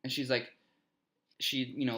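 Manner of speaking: ordinary talk, nothing marked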